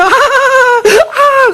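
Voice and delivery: long drawn-out cry, very high, half laugh half sob; stretched-out shrieking laugh that tips into crying; mad laughing and wailing. Retold or imitated. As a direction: wail and cackle like a crazed mother smashing melons. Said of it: "박수치고 울고 깔깔거리고".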